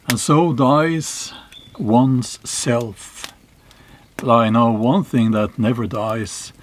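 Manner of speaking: put-on slow, weighty reading of old verse; measured saga recitation with long pauses; solemn poem recital. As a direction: intone it like an ancient saying.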